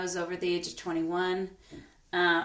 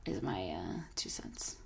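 soft unvoiced sign-off; whispering; 11 semitones lower, 9 dB quieter, slower than usual. Real, not acted. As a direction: whisper it as an aside.

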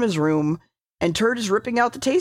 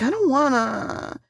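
slow, higher reluctant whine; mock whining; 4 semitones higher, slower than usual. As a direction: drag it out like a sulky refusal. Acting a reluctant refusal.

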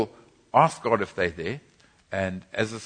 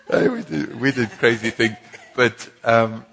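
laughing; 2 semitones higher, 6 dB louder than usual. Real, not acted.

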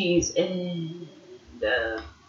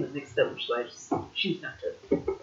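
whispered; 4 semitones lower, 8 dB quieter than usual. Real, not acted. Unvoiced aside.

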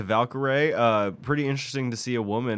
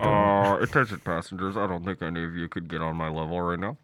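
pilot announcement; 6 semitones lower than usual. Pretend. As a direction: talk like a captain on the PA. Pretend pilot over intercom.

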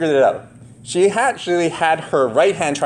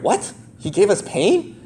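surprised; 8 semitones higher than usual. Retold or imitated. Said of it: "it kinda sounds like".

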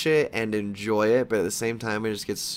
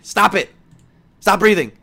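exasperated scolding; 8 semitones higher, 8 dB louder than usual. Acted. Scolding the character.

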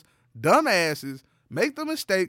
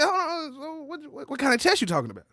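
incredulous; 9 semitones higher than usual. Retold or imitated. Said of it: "I said".

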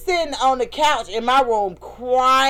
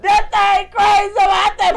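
raised voice; 9 semitones higher, 4 dB louder, slower than usual. Real, not acted. Laughing retelling, raised voice.

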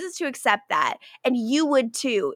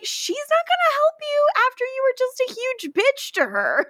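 high-pitched incredulous protest; exasperated retort; 13 semitones higher than usual. Acted. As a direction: push it way up high, exasperated at her. Host's exasperated retort to character.

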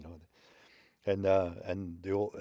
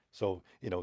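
plain reading voice

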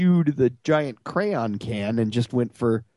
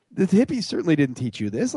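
ordinary talk

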